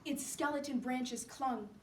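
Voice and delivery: plain reading voice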